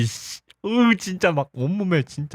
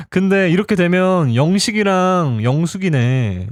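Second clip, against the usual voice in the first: normal talking voice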